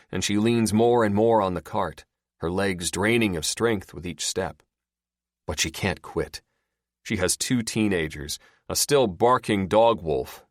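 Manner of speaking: ordinary delivery, nothing marked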